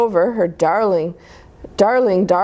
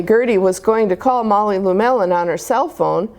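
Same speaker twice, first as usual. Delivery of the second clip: ordinary delivery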